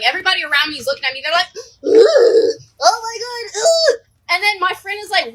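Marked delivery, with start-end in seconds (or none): 1.6-4.0 loud, high-pitched groans of revulsion; mock disgust. Everybody around watching her vomit, mock disgust. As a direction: recoil out loud like grossed-out onlookers.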